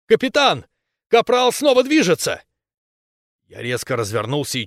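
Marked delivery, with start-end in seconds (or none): 0.1-2.4 loud, high urgent cry; excited alarm. A narrator's reading. Professor alerting the captain to movement, excited shout. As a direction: shout the startling news. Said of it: "профессор Ли возбуждённо постучал".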